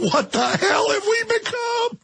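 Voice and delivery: high, crying lament; anguished cry. Real, not acted. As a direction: wail it in despair.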